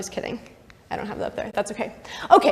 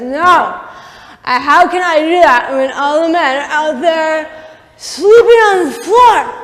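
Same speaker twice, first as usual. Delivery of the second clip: loud, high, hammed-up protest; over-the-top righteous outcry; indignant refusal; 5 semitones higher, 9 dB louder, slower than usual. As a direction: belt out an outraged refusal, drawing out the end. Uriah refuses David with indignation.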